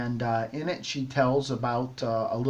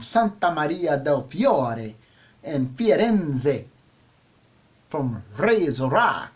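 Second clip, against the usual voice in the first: slow, hammed-up Italian accent; playfully overdone Italian accent, drawn out; bad Italian accent; slower than usual. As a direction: roll it out in a mock Italian accent. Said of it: "my really bad Italian accent there".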